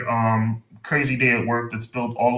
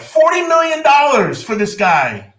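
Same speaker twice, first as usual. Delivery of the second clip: incredulous; 13 semitones higher, 5 dB louder, slower than usual. Real, not acted. Outraged at quarterback's salary.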